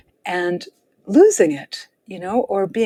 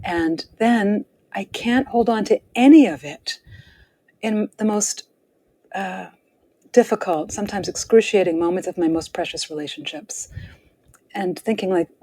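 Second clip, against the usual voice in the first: ordinary talk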